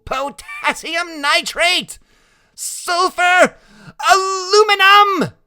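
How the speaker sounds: high, loud, stretched-out yells; shouting out names. A narrator's reading. Freak calling out chemicals as rockets burst, shouting high. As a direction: yell each name in high excitement. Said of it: "Freak keeps on shouting out the names of chemicals".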